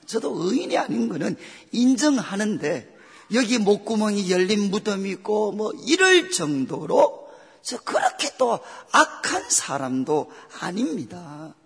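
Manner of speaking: usual speaking voice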